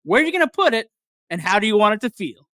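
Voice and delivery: hammy stand-up punchline with a rising peak; comedian delivery. Pretend. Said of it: "when I deliver it like that, like a comedian".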